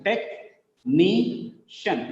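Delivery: very slow "technician", tech, NI, shun; syllable by syllable. Shown as a demonstration. Teacher counting syllables, "technician" split into tech, NI, shun. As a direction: break "technician" into three beats. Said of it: "three syllables are there".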